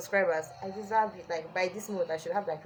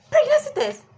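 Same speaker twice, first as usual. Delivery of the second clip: much higher, louder mock-innocent answer; playing innocent with a high squeal; 11 dB louder than usual. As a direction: chirp it up high and loud. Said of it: "I'm like".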